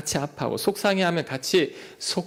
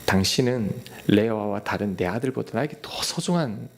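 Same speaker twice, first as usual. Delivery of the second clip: tender comfort; 3 semitones lower than usual. Pretend. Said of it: "이렇게 말을 했을 것 같습니다". (우는 아내를 다정하게 달래는 남편의 부드러운 목소리)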